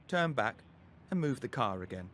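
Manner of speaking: ordinary delivery, nothing marked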